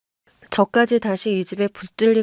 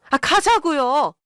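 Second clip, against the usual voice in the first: higher, drawn-out insistence; exasperated urging; 5 semitones higher, slower than usual. A narrator's reading. Daughter-in-law urges mother-in-law to leave, exasperated. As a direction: press her with slow, stretched words.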